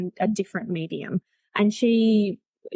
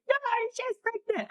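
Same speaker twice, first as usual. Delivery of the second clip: put-on much higher, softer little-old-lady voice; grandma insisting; 5 dB quieter than usual. Grandma from the other side insisting, in a high tiny voice. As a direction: squeak it out like a stubborn grandma from beyond. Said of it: "my grandma was over the other side just going".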